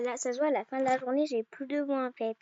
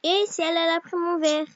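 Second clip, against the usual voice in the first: ordinary talk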